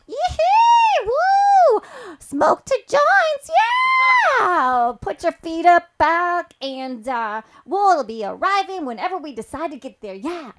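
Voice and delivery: a wild stoner car voice hollering; loud, slow party-dude ride announcement; rowdy party voice. Retold or imitated. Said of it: "accidentally hit the button, and it's like".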